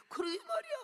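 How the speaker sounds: high, wailing exclamation of agreement; high heartfelt agreement. Retold or imitated. Frightened believer admits the fear to self, high wailing agreement. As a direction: cry it out high, giving in completely.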